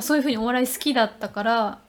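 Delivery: ordinary talk, nothing marked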